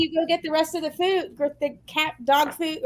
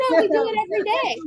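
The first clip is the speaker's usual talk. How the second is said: fast, pitched-up clerk voice; quick high mock clerk; 6 semitones higher, faster than usual. Retold clerk brushing her off, quick high mockery. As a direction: rattle it off like the clerk. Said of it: "And she goes".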